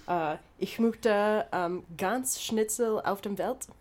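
slow, halting German on request; slow careful German speech; German accent. Shown as a demonstration. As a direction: grope through the German slowly. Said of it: "Please say".

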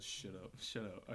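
low, hushed "shut up"; soft, low aside to silence someone; urgent hushing. Acted. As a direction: quietly shush a friend under your breath. [name] hushes the player, in character.

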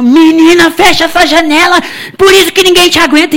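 exasperated scolding. Retold or imitated. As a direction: snap at the cheeky girl. Mother scolding daughter.

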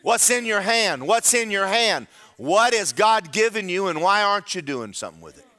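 full-force preaching. Acted. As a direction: press each question hard on the congregation.

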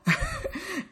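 short high laugh; quick laugh at her own comeback. Real, not acted.

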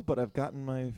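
usual speaking voice

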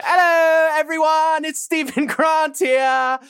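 high, hammed-up English accent climbing as it goes; odd English accent. Podcast host introducing the show as an avatar, odd English accent. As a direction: put on a bad British accent, pitched well up, drifting Australian. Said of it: "very odd English accent".

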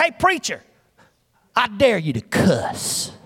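slow, drawn-out schoolboy dare; imitating a taunting classmate; mock bully taunt. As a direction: call out, then drag out the dare.

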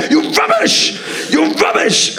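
very high, yelled insult; mock angry shout. Outraged audience member yelling at comedian, very high mock shout. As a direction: scream the insult like an outraged punter. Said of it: "he shouted at me".

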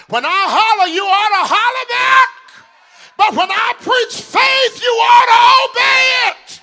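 high, loud climactic preaching; preaching holler. Real, not acted. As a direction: drive it home at full force.

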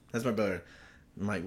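ordinary talk, nothing marked

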